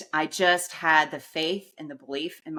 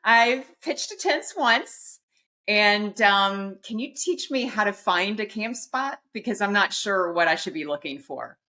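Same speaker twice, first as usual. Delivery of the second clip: naive newbie; 5 semitones higher than usual. Retold or imitated. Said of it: "I said".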